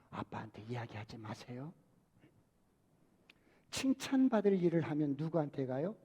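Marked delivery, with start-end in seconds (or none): 0.1-1.7 put-on hushed, much softer child asking mom to keep a secret; soft conspiratorial child voice; hushed child plea. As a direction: whisper it like a kid hiding trouble from dad.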